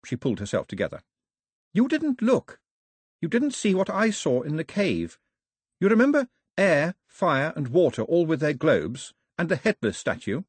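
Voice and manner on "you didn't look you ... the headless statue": put-on high, eager girl's voice; high-pitched young heroine pressing her point; Daphne, insistent. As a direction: urge him to remember, pitched well up. Narrator voices Daphne pleading her case.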